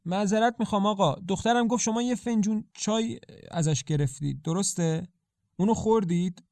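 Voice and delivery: a worried mother, pitched high and urgent; distraught mother. A narrator's reading. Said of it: "مادر آشفته و پریشان".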